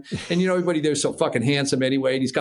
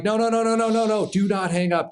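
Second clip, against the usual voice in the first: urgent pleading; 9 semitones higher than usual. Retold or imitated. Acted pleading on the phone.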